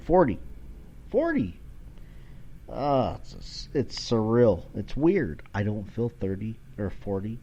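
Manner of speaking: normal talking voice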